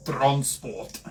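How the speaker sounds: clipped South African accent on transport. Shown as a demonstration. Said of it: "Try and say in a South African accent".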